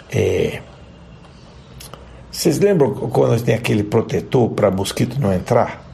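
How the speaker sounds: ordinary talk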